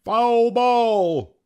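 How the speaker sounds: loud, high umpire's call; umpire's yell. A narrator's reading. Umpire calling a foul hit, yelling. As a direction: holler the call to the whole park. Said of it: "yelled the umpire".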